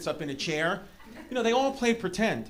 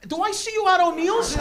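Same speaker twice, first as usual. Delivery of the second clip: high, loud mock local guy; umpire unmasked; 13 semitones higher, 7 dB louder than usual. Umpire recognizing an acquaintance, loud high put-on voice. Umpire drops his fake accent.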